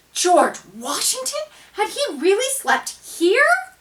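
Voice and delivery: high, loud astonished question; amazed. A narrator's reading. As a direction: gasp it out in disbelief. Amazed discovery.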